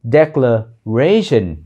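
"Declaration" very slow, long stressed RA. Shown as a demonstration. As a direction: draw out RA in "Declaration". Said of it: "cái chữ re mới là âm chính".

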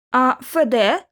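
ordinary delivery

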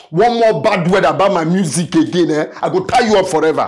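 loud, high-pitched warning; angry threat. Acted. Kidnapper warning captive about insulting his music, angry. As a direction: lay down a furious threat.

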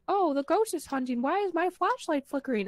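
a hint of a panicked beginner; clueless newbie. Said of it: "everyone new is like".

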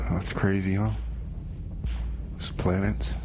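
ordinary talk

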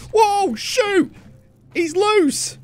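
high startled shout; real jump scare yelp; startled yell. Real, not acted. Sudden creature attack in game.

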